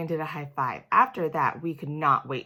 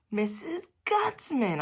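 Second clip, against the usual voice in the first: excited shout; 9 semitones higher, slower than usual. Acted. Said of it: "I shouted".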